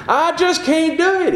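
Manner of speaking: high-pitched mock despair; acted helplessness. Preacher voicing a believer daunted by man, helpless. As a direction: throw up a high, overwhelmed protest. Acting out a fearful believer giving up.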